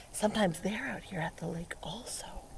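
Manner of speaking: soft unvoiced whisper. Teacher whispering an aside to the kids. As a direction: lean in and whisper it.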